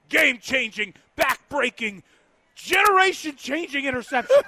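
loud, high, hammed-up play-by-play shout; over-the-top sportscaster call. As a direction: imitate a bombastic announcer, each phrase building. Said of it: "his call was, like".

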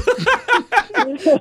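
squealing laugh, pitch way up; high burst of laughter. Real, not acted. Host laughing at caller.

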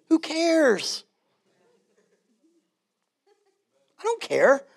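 high, airy carefree toss-off; breezy shrug. Real, not acted. Pastor shrugs off non-essential doctrines, high and breezy.

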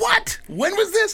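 very high-pitched disbelief; shocked. Retold or imitated. Comic reacting to the agent's gossip, shocked. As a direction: squeal the question in mock shock.

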